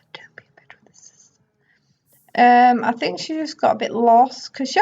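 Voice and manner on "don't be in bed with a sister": rushed whisper, no voice. Pretend. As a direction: hiss it fast under your breath. Worried she is with sister.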